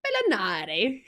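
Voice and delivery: hammed-up Italian accent through a laugh; mock Italian accent, playfully overdone. Shown as a demonstration. Streamer trying out an Italian surname, mock Italian accent. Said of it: "very vast knowledge of Italian language".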